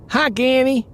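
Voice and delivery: put-on small boy. Little grandson greeting his granny, high and bright.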